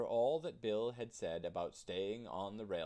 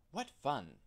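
higher, louder delight; excited; 5 semitones higher, 5 dB louder than usual. A narrator's reading. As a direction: burst out with glee. Tootle delights in meadow.

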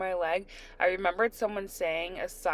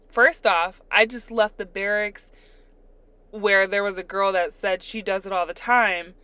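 ordinary talk, nothing marked

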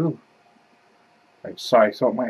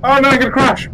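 high panicked cry; playful alarm, loud; mock panic; 9 semitones higher, 6 dB louder, faster than usual. About to crash the ship.

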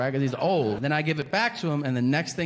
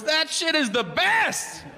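blissed-out stoner; 12 semitones higher, slower than usual. Retold or imitated. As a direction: gush like a happy old junkie. Old driver praising smoking rocks.

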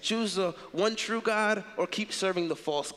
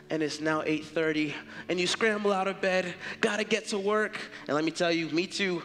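ordinary talk, nothing marked

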